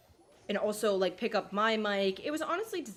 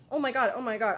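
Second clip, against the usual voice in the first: excited; 4 semitones higher, 7 dB louder than usual. Real, not acted. Thrilled after win.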